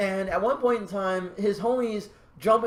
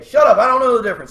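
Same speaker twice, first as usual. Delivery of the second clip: loud mock annoyance; 6 semitones higher, 11 dB louder, faster than usual. Real, not acted. Playful retort to correction.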